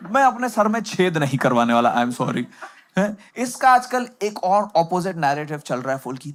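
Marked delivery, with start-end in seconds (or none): none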